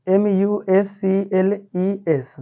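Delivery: put-on drawn-out letter-by-letter spelling for children; slow spelling out. Shown as a demonstration. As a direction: spell each letter very slowly, one by one. Teacher spells word letter by letter. (बच्चों के लिए धीरे-धीरे अक्षर बोलना)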